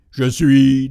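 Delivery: loud, hollow ghostly voice; sepulchral voice. Acted. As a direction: speak like a ghost from the grave. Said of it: "dit une voix sépulcrale".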